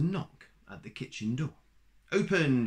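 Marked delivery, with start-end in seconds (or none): 2.1-2.7 loud command. A narrator's reading. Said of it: "she said to the door".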